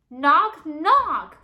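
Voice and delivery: loud high shout right in his ear. A narrator's reading. Father shouting into his brooding son's ear, loud shout. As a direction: blast it into the boy's ear. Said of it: "Fergus shouted".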